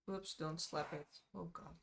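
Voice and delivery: ordinary talk, nothing marked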